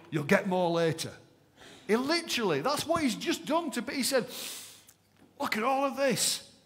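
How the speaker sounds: high, loud, thrilled; bursting with glee at the surprise; excited. Acted. Preacher voicing Jesus's joy to disciples, thrilled.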